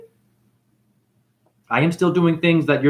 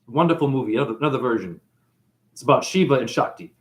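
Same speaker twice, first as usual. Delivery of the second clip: ordinary delivery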